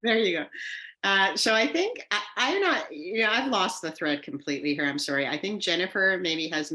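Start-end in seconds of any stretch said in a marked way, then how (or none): none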